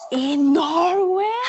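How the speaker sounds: disbelieve the answer outright; mock confused tourist; baffled surprise. Confused guest repeats direction.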